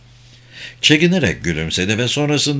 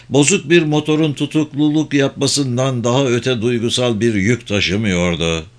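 ordinary delivery, nothing marked

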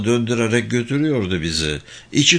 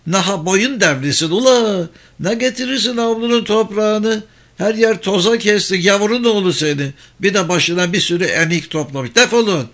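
high, raised-voice telling-off; angry scolding; 9 semitones higher, 4 dB louder than usual. Acted. Old woman scolding boys over the dirt, angry high shouting. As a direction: scold the children and chase them off.

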